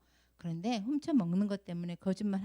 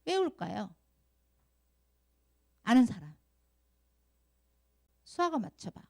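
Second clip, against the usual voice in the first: usual speaking voice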